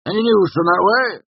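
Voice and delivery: high, loud rustic query; nosy tavern regular. A narrator's reading. Villager asking the traveller for news, nosy. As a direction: press eagerly for news.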